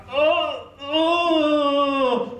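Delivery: two high groans, the second drawn out; pained groans. Shown as a demonstration. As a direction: moan with each cramped step. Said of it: "I was like this".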